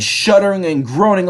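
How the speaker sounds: usual speaking voice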